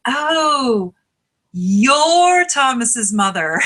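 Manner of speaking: high, drawn-out greeting on a swelling "Oh"; imitated pleased teacher. Teacher greeting the star pupil's mother, delighted recognition. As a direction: stretch it out like a teacher thrilled to meet her. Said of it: "I'd go up into one class and I'd get".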